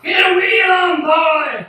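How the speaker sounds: gruff loud shout across the room. Acted. Grumpy old man hollering at the young clerk, loud shout. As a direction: bark the order loudly.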